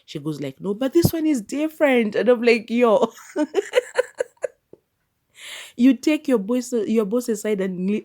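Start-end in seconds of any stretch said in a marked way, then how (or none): 0.6-2.1 high, loud acted protest; imitated insistence. Acts the secretary pleading the case. 2.1-3.1 loud, high, laughing exclamation; amused disbelief. Real, not acted. Her own amused shock at the scene. 3.2-4.5 very high burst of giggles; laughing. Real, not acted. Laughing at the secretary's nerve. 5.8-8.0 loud, high playful reproach; mock scolding.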